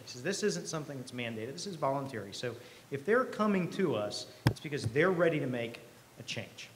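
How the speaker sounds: usual speaking voice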